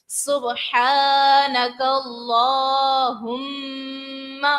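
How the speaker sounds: سبحانک اللہم with the final میم held and nasalised; nasal ghunnah on meem. Shown as a demonstration. Teacher showing students the ghunnah on the doubled میم. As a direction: hum the مّ of اللہم through the nose. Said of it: "میم کی آواز ہماری ناک میں جائے گی".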